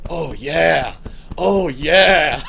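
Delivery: loud, hammed-up moan of pleasure; hammed-up mock moan, laughing. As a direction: fake a dirty groan as a prank. Friend pretends to jerk off.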